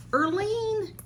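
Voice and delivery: high delighted call of a name; crying out a friend's name in wonder; excited cry. Real, not acted. Finding a Coach bag, calling her friend's name high.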